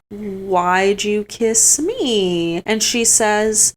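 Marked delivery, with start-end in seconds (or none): 0.1-2.6 very slow, drawn-out question; Kane slow question. Retold or imitated. Kane asking Helena why she kissed him, very slow drawn. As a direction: stretch every word, gently puzzled. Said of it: "And he's like".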